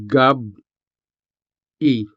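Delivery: Gab-i with a sharp stop between gab and i; Gab-i, hard break in the middle; glottal stop. Shown as a demonstration. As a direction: cut the air after gab, then say i. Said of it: "Notice the sharp pause".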